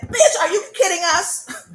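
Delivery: high, laughing mock scold from the ancestors; sassy ancestor. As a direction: ancestors calling her out, half-laughing. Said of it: "And my ancestor said".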